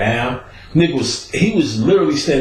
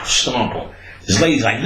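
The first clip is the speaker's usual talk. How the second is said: ordinary talk, nothing marked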